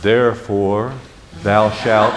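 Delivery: low, stiff, formal pronouncement; mock the stiff King James Jesus; a lightly voiced solemn preacher. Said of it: "he's always like this".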